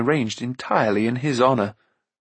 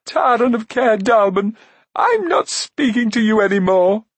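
high, loud acted fury; sobbing tantrum; 10 semitones higher, 5 dB louder than usual. A narrator's reading. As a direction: explode at him in a high, hurt shout. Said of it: "Sobbing, Eilonwy stamped her foot".

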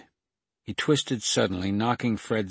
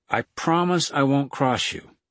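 higher, louder pleading promise; begging; 6 semitones higher, 5 dB louder than usual. A narrator's reading. Kate begging the gunman to trust her, high plea. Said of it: "Kate begged".

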